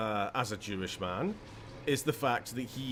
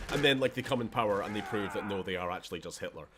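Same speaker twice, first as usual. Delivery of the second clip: normal talking voice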